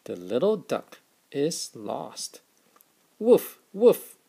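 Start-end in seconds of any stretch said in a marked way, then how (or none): 3.2-4.2 high, loud playful woof woof; cheerful doggy barks. Acted. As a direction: bark like a puppy for the kids. Dad barks for the dog.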